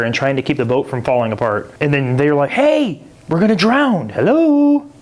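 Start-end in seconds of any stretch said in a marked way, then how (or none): 2.5-4.9 high, frantic mock panic; panicked disciples. Disciples to sleeping Jesus, high mock panic. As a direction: squeal it like panicking sailors.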